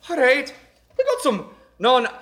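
playful Irish accent toast. Shown as a demonstration. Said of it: "can you do Irish accent as good as I can".

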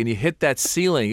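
usual speaking voice

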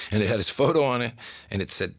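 ordinary talk

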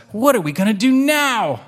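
put-on high, whiny-kid style kitten voice; high-pitched mock dismay. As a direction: act out a kitten at a loss. Kitten despairing in story read aloud.

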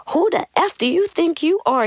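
high, outraged mock indignation; indignant outburst. To the therapist suggesting medication, indignant high outburst. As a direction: throw it back at her, offended.

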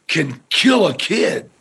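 ordinary talk, nothing marked

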